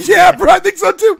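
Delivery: high, loud exclamation; retold loud agreement. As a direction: burst out in acted agreement. Exclaimed reply to shaken buddy.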